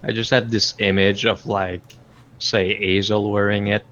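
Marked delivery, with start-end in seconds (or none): none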